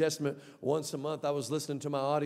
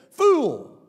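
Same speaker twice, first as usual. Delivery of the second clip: high, loud shout falling away; explosive one-word condemnation; thundering rebuke; 8 semitones higher, 11 dB louder than usual. Acted. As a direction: fire the word out as a verdict. God's word repeated as shout.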